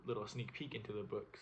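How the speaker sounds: ordinary delivery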